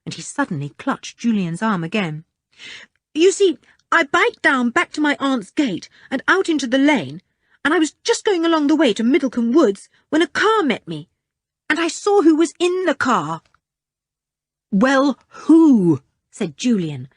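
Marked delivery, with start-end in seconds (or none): 3.1-13.4 put-on higher, hurried boy telling his story; a scared boy rushing his tale; frightened boy Richard. A narrator's reading. 14.7-16.0 loud, impatient, pressing; impatient demand. A narrator's reading. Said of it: "could shake Richard".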